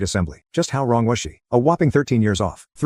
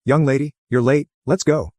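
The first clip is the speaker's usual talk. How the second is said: higher, pushy teacher scolding; insistent; 6 semitones higher than usual. A narrator's reading. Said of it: "she persisted".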